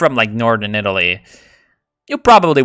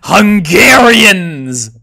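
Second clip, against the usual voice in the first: loud, high, slow drawn-out cry; dramatic exclamation; 7 semitones higher, 8 dB louder, much slower than usual. Real, not acted. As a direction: stretch it out in mock horror.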